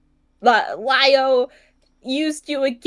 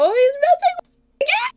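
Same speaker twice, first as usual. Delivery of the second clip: high, fast whiny voice, mocking the crybaby; squeaky mock whimper of someone crying wolf; faster than usual. Mimicking the crybaby who says he's being messed with, high and whiny. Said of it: "You start crying again about how".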